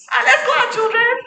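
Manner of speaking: high, loud, cheery mother's call; cheery mom. Retold or imitated. Mother calling children out for pizza, high and loud. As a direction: sing out the outing like a jolly parent.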